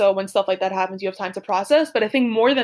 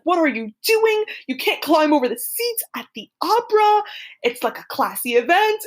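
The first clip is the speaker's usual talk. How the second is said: higher-pitched mock scolding, cranky and indignant; imitating a cranky man yelling; 7 semitones higher than usual. Cranky man scolding a student climbing over opera seats, higher mock yell. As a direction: play the cranky operagoer telling off a kid. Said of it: "He's like".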